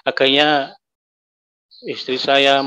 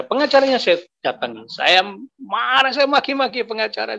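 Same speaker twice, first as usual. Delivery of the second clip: raised pitch, stretched-out indignant retelling; retold anger; 8 semitones higher than usual. Man tells congregation how he cursed his wife's lawyer, retold anger. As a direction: relive the outburst at the lawyer, voice climbing.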